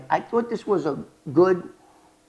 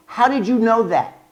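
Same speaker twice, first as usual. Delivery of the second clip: suspicious; 6 semitones higher, 6 dB louder than usual. Retold or imitated. Agent suspicious.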